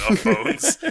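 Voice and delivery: laughs through the words; breaking into laughter; laughing. Real, not acted. Laughing at skeleton gag.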